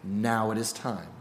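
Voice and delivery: low, soft reassurance; kindly God. Acted. God reassures Moses, low and soft. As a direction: settle him gently. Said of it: "God says".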